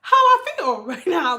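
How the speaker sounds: high loud laughing cry; laughing hysterically. Retold or imitated. Sister overwhelmed on the FaceTime call, high loud laughing. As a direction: crack up while saying it. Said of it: "I'm like".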